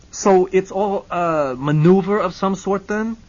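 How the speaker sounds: loud, high, slow wondering question; cautiously relieved client; relieved Hennessy. Acted.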